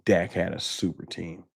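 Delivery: low, soft scornful muttering; quietly seething; pissed off. Real, not acted. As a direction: repeat their claim under your breath in disgust. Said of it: "Pissed me off".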